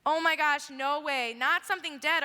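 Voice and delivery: put-on a boy crying out in terror in the dark; panicked near-scream at an unseen voice; almost screaming. A narrator's reading. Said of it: "he almost screamed".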